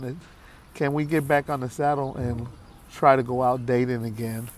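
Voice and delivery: ordinary delivery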